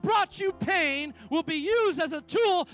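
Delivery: loud, rising, slowed preaching climax; full-force preaching. Acted. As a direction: build up to the altar call.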